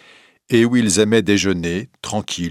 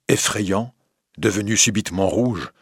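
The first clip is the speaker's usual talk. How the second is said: ordinary delivery, nothing marked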